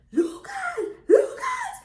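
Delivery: high, loud retold holler; screaming his name. Wife outside searching for her napping husband, screaming his name. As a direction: yell the name out, pitch leaping way up. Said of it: "screaming his name".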